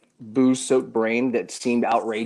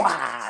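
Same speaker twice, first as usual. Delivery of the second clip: drawn-out "mwah" kiss sound; kiss noise; 3 semitones higher, 3 dB quieter than usual. Shown as a demonstration. Drunk friend running up to kiss you, playful smooch. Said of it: "run up to you and go".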